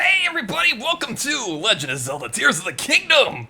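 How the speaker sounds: big, high, loud YouTube intro; announcer voice. Shown as a demonstration. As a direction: hype it up like an announcer opening a video. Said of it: "Your announcer voice".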